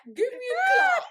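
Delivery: high and loud joke German command; mock German accent. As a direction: belt it out in a hammed-up German accent. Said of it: "German accent".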